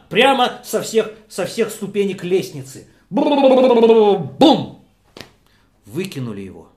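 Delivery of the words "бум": thud sound. Acted. Thrown down the stairs.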